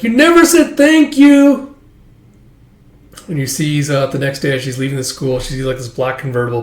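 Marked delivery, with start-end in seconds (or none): 0.0-1.7 high, loud, drawn-out complaint; indignant shout. Acted. Girl scolding the man as he goes, indignant shout. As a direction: holler it after him, indignant.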